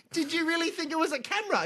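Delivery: high, fast mocking disbelief; imitating mum laughing at him; teasing mum. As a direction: tease him like a nurse mum. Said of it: "she was like".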